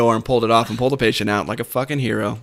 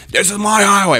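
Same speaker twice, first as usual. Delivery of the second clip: higher, bickering comeback; escalating territorial insistence; 6 semitones higher than usual. Retold or imitated. Cop arguing back and forth with paramedic, more heated insistence. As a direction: repeat the claim higher, digging in. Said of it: "goes back and forth".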